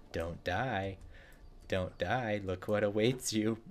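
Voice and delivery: a soft, creepy little whisper of a pepper, lightly voiced. The habanero on his desk taunting him, softly creepy. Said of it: "That's how a habanero talks".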